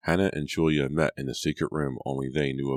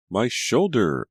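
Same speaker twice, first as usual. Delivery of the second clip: loud, higher shriek of pain; 7 semitones higher, 6 dB louder, slower than usual. A narrator's reading. Scalded daughter at dinner, loud pained shriek. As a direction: cry out in sudden burning pain. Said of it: "Julia shrieked".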